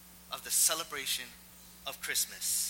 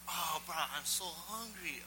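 slow, drawn-out, higher-pitched moan; imitated wheedling local beggar; begging stranger; 4 semitones higher than usual. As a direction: plead pitifully like a hungry guy.

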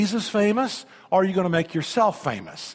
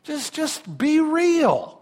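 high, slow, drawn-out plea; Jesus pleading; 9 semitones higher, much slower than usual. Retold or imitated. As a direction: stretch it out like a gentle, earnest appeal. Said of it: "And so Jesus is going".

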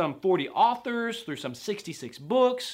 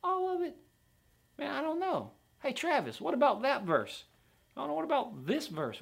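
high-pitched mock doubter; incredulous doubter; 9 semitones higher than usual. Sceptical listener challenging the preacher about a verse, incredulous. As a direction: question it in a high, put-on sceptic's voice. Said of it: "Some hear that and they're like".

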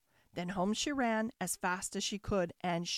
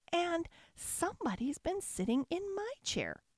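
higher-pitched mother bear voice; 7 semitones higher than usual. A narrator's reading. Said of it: "said the mother bear".

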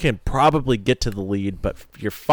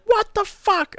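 much higher, startled yelp of disbelief; shocked outburst; slower than usual. Retold or imitated. Wife watching the dog bolt through the pits, shocked high yelp. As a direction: squeal it like you can't believe your eyes. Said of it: "and Lindsay's like".

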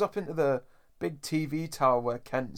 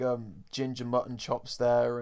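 ordinary talk

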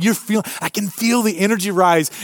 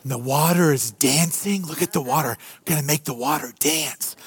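softer, hushed painter's voice; soft Bob Ross voice; 7 semitones lower, 6 dB quieter than usual. Retold or imitated. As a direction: gently hush it like a TV painter. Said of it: "he would talk really quiet".

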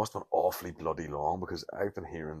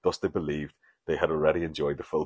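normal talking voice

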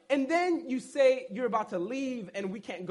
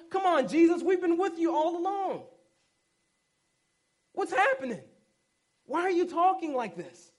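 higher, pleading puzzled voice; confused disciples; 5 semitones higher than usual. Retold or imitated. Disciples pleading with Jesus about his cryptic words, higher puzzled voice. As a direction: play the bewildered friend begging for answers.

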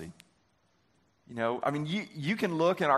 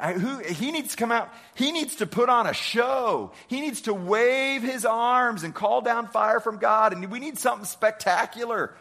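mocking demand; 5 semitones higher than usual. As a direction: mimic a man demanding a show. Mocking Naaman's demands for spectacle.

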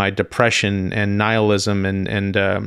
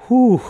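a high, loud whew of disbelief; overwhelmed whew; 13 semitones higher, 4 dB louder than usual. Real, not acted. Telling listeners about a terrifying message, a high loud whew. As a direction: blow out a high astonished whew.

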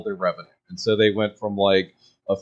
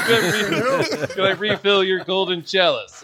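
laughing mock servant; 8 semitones higher than usual. Joking offer in mock Irish.

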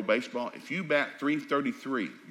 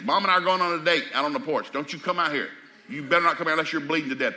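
louder, higher mock warning to the kids; mock stern dad; 5 semitones higher, 6 dB louder than usual. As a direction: bark it playfully at the kids, louder.

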